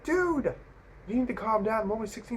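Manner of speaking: high-pitched protest, easing off in volume; exasperated plea. Retold or imitated. Retold plea to angry driver.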